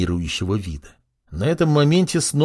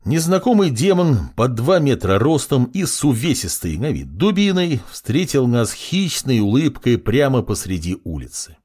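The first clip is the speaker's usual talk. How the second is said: ordinary delivery, nothing marked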